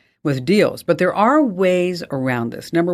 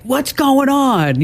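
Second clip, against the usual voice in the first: slow, much higher squeak of mock alarm; 11 semitones higher, much slower than usual. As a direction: play it up like a startled homeowner. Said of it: "when I'm like".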